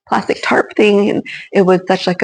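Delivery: ordinary talk, nothing marked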